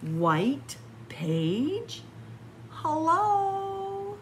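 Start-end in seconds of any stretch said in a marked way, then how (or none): 2.7-4.2 much higher, drawn out hello; a small hopeful hello; lonely hello. Acted. Reading to kids, the chameleon says a high drawn hello.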